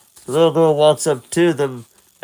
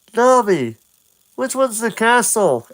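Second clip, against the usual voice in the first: put-on higher boyish question; Link asking; 9 semitones higher than usual. Link asks Navi, higher boyish voice. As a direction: ask like a curious boy. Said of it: "asks Link".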